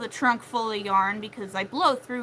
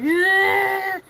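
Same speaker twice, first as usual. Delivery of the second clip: high, loud anxious cry of yeah; panicked yeah; 10 semitones higher, 9 dB louder than usual. Retold or imitated. Brother nervous about the wedding, loud anxious yelp. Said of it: "he's like".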